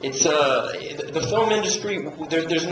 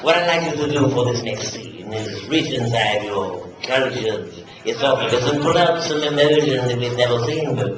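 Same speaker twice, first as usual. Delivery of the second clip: pompous director; 7 semitones lower than usual. Retold or imitated. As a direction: address the cast with theatrical self-importance. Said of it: "who says things like".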